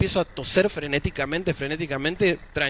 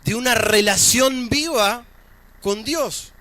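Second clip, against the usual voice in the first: higher-pitched, projected sermon delivery; preaching; 8 semitones higher, slower than usual. Acted. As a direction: preach it in measured, emphatic phrases with pauses. Preaches to the congregation.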